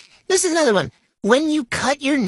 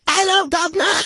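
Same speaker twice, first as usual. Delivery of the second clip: higher mock British accent; hammed-up British accent greeting; 10 semitones higher than usual. Mocking husband's habit, fake British accent. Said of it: "You're not even from the U K".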